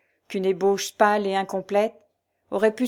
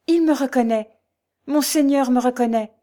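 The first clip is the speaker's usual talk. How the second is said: higher and louder, exultant; wild exaltation; 4 semitones higher, 5 dB louder than usual. A narrator's reading. Nurse rejoicing that her lord recognises her, exultant. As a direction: burst out in joy. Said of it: "avec une exaltation folle".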